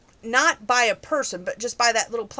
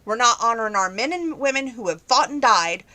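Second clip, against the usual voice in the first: ordinary talk, nothing marked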